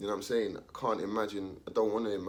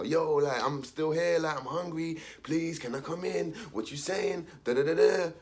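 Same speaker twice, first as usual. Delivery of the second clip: higher, hungry begging; pleading voice; 6 semitones higher, 3 dB louder than usual. Retold or imitated. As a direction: beg to be let in. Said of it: "Third song now".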